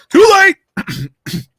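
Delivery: very high, loud comic wail; hammed-up cry. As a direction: squeal it out melodramatically. Acted reply in the skit.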